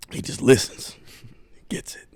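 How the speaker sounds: breathy, unvoiced half-whisper; mock dad murmuring; hushed dad impression. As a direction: let it fade to a hush. Dad quote continues, hushed.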